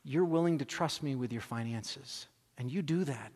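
God gently questioning his son, hushed and tender; gentle voice of God. Retold or imitated. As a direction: speak tenderly, like a loving father.

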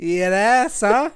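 loud, high Australian jeer; mock Aussie accent. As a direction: bark the jab as an Aussie. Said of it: "Aussie bit continues".

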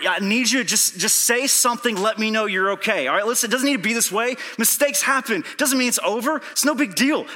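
loud, high voice climbing in urgency; frantic shouted reassurance to a friend on the phone; desperate pleading. Retold or imitated. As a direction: plead hard for a reply. Retold escalating phone plea.

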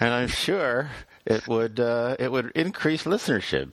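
lips barely moving, mouth kept still; talking without moving mouth. Shown as a demonstration. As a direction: keep talking with the mouth almost shut. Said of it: "talk without moving my mouth".

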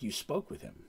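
hint at dark things ahead; eerie Karina voice. Retold or imitated. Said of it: "It's like".

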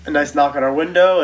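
ordinary talk

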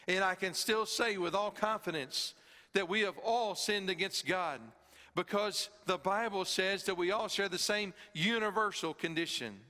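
preaching at full force. Acted. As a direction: preach it out to the congregation with rising force.

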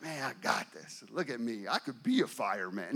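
low, quiet, self-satisfied boast; deep-pitched, softly smug; cocky self-talk. Retold or imitated. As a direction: mutter proudly to yourself, full of swagger. Self-satisfied boast while carrying kid.

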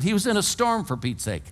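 ordinary delivery

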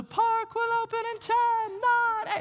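squeaky, bouncy mouse announcer; Mickey Mouse. Retold or imitated. Mascot counting down the park opening to the crowd, high cartoon voice. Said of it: "Mickey Mouse comes out ... is like".

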